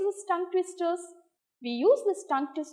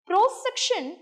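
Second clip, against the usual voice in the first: usual speaking voice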